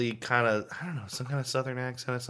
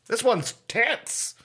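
stretched out and much higher; imitating an excitable vape reviewer; 10 semitones higher, much slower than usual. Vape host gushing over a device, slow and high. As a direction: ham up the hyped vape guy. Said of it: "always screaming about this shit".

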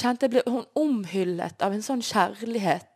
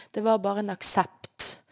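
usual speaking voice

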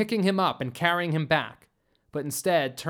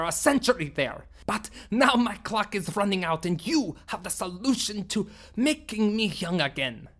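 higher, furious outburst that stays raised; angry alien leader; 5 semitones higher than usual. Acted. Sumblok accusing Bellry of betrayal, furious and bitter.

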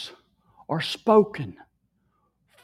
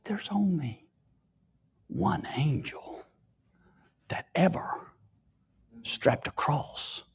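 hushed whisper; 4 semitones lower, 7 dB quieter than usual. Retold or imitated. Preacher whispering his own words back to the crowd, hushed. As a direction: whisper it like a holy secret.

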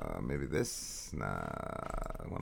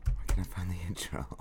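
hurried whisper while searching; frantic whisper; 4 semitones lower, 12 dB quieter, faster than usual. Real, not acted. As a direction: whisper it fast, scrambling.

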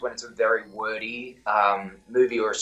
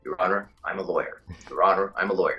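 lower, masculine American voice; American accent; 5 semitones lower than usual. Shown as a demonstration. Actor drills phrase to get into the role, deep American accent. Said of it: "an octave and it becomes very masculine".

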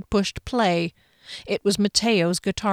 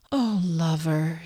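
low, soft, slow husky purr; 3 semitones lower, 4 dB quieter, slower than usual. A narrator's reading. Ex-boyfriend sweet-talking her in a husky voice. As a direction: melt her with a husky murmur. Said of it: "in the husky voice".

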